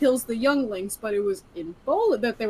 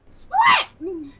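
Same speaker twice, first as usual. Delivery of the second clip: higher, loud call of Split!; bowling screen shout; 7 semitones higher, 4 dB louder than usual. Acted. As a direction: call it like the lane screen flashing.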